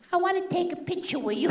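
put-on high, soft little old lady voice; old Sicilian woman. Eighty-year-old fan asks comedian for a picture, old Sicilian woman voice. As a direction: imitate a tiny elderly Sicilian woman. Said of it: "an eighty-year-old Sicilian came up to me".

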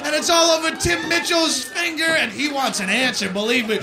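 ordinary talk, nothing marked

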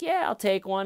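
high-pitched, breezy agreement; mock willingness. Mimics suspect's eager, insincere agreement.

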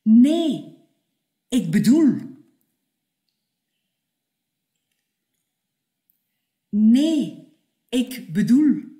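loud "Nee, ik bedoel"; loud insistence. Acted. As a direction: cut in firmly. Repeated emphatic correction. (luid en stellig)

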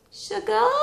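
high, drawn-out, dreamy; craving voice. Pretend. Her stressed body begging for sugar, high and dreamy. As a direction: croon the word like a craving.

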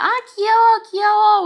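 loud, high-pitched squeal of adoration; cute gushing. Retold or imitated. Daughter telling her dad he is cute, gushing. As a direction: gush as if at something adorable.

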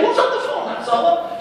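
high, loud and rushed with a laugh; alarmed question. Retold or imitated. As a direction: call out in confused alarm. Frantic question amid screaming women.

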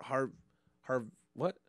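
usual speaking voice

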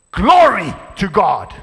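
loud, slow, ringing praise; joyful cry. Retold or imitated. Preacher voicing son's joyful WhatsApp message, loud. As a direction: shout it out in joy. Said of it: "Guess what the next words".

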